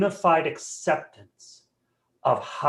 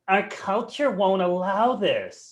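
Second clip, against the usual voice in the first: whiny complainer; 5 semitones higher, slower than usual. Retold or imitated. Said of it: "The other one that".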